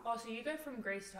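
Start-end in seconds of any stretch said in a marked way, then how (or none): none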